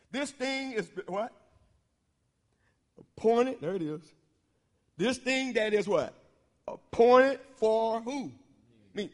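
leading the church to answer back; preacher prompting the congregation; preacher call and response. Real, not acted. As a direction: ask it and let the congregation finish the line.

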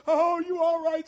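loud, high, slow cry of alarm; startled driver yelling; worried shout. Retold or imitated. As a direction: call out in a panic to the injured boy. Truck driver calls to hurt boy.

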